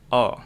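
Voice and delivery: O said with a wide-open mouth; wide, open "O", unlike Indonesian O. Shown as a demonstration. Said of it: "mulut kita agak lebar".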